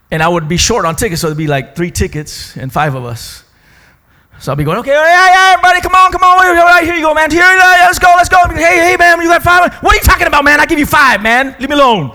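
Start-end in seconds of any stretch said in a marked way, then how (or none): 4.8-12.1 high, loud, rapid hustling; excited hustle. Retold or imitated. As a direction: hurry the group through, loud and high. Acted ticket-line hustle with friends.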